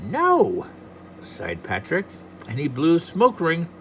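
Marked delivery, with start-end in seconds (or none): 0.1-0.6 high sighing little leprechaun; a weary little no. Acted. Patrick sighs no.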